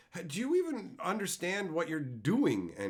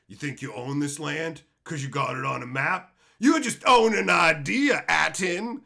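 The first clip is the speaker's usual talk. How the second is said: loud, slow sneering proclamation; hammed-up taunt; mocking the character; 10 dB louder than usual. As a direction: parody the smug line. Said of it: "and go".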